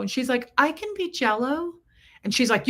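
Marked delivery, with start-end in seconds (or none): 0.6-1.7 higher-pitched, hopeful retort; lightly acted insistence, pitched up; hopeful movie quote. Imitating the movie rival insisting she can change, hopeful.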